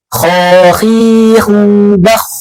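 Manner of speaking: slow, heavy tajwid sounding of "bakh"; heavy letter demo. Shown as a demonstration. Teacher shows students a heavy kha, slowly sounded. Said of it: "dibaca tebal".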